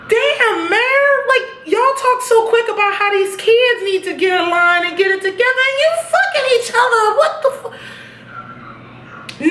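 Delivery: high, shocked scolding of the mayor; gasping at the hypocrisy; scandalized outrage. Real, not acted. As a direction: call her out in disbelief.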